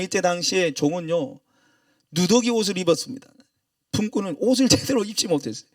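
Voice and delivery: ordinary delivery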